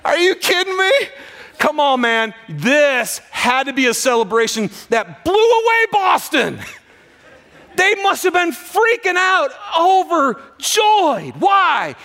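high, loud, impassioned preaching; preaching at full force. Real, not acted. Pastor telling the church of the resurrection joy, impassioned shout.

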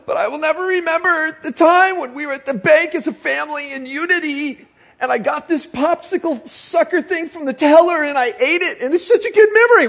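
high-pitched mock-tearful kid's plea; exaggerated, high weepy little-girl voice; tearful child. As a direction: imitate a child wailing through tears. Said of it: "she came in tears".

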